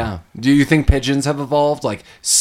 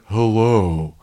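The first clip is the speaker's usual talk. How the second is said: lightly lowered, dragged-out caller; slowed Talk Boy voice; 2 semitones lower than usual. Retold or imitated. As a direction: play it like slowed tape. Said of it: "slows down the audio".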